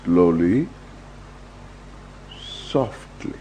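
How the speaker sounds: ordinary delivery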